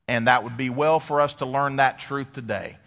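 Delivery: usual speaking voice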